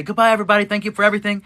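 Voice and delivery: high, loud put-on streamer farewell; cheery sign-off. Streamer ending the night for viewers, imitated cheery sign-off. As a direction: sing out a bright public goodbye.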